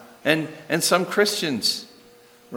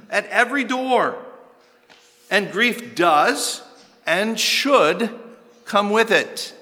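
higher, driving sermon voice with heavy emphasis; impassioned preaching; 9 semitones higher than usual. Real, not acted. Preacher urging the congregation that of course they should grieve, impassioned. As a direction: preach it forcefully, punching the key words.

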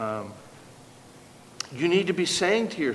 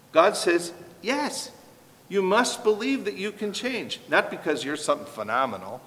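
ordinary talk, nothing marked

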